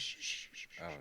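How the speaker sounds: soft shushing whisper; whispering over a crib. Pretend. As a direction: hush them like a baby. Shushing while rubbing face.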